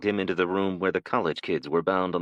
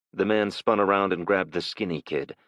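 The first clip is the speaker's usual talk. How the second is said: plain reading voice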